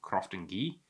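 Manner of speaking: Crofton Gee, last word one syllable with an ee vowel; pronunciation he was told. Shown as a demonstration. Host passing on Tommy's version, ee pronunciation. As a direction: say "Crofton Gee" with Gee rhyming with key. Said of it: "then I was told by".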